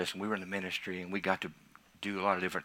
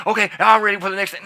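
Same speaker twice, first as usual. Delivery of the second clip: higher, louder and fast, impatient; mock eager believer; 10 semitones higher, 11 dB louder, faster than usual. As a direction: rush ahead like you can't wait. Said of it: "voicing impatient believer".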